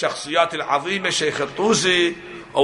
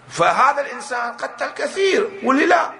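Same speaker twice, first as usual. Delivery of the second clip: raised preaching voice; 7 semitones higher than usual. Real, not acted. Preacher driving home a point.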